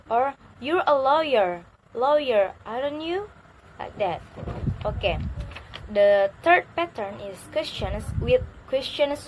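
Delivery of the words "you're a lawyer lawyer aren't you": "lawyer" falling, then "aren't you" rising; tag question intonation. Shown as a demonstration. Teacher giving another tag question example, "aren't you" rising. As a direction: let "lawyer" drop and lift "aren't you". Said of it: "convey through a different intonation pattern".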